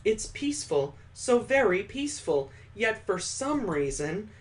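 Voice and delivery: a hint of a frightened young woman; [name]'s unease. [name] confused and scared.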